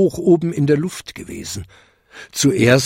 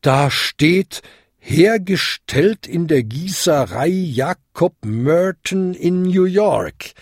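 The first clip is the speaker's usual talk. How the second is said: higher, deliberate shocked announcement; Ding ringing hollowly; 7 semitones higher than usual. A narrator's reading. Bell reveals the inscription, higher and appalled. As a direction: ring out each part, slowing at the end. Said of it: "Ding läutete hohl".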